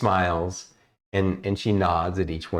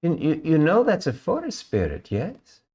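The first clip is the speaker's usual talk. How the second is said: put-on higher, sweet, reserved young elf; elf ranger voice; 9 semitones higher than usual. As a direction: speak as a sweet shy elven ranger, a little higher. Elf ranger talks to the girl.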